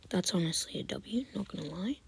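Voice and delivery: soft confiding whisper to the camera; whispered aside. Real, not acted. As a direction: let them in on a secret. Aside to viewers.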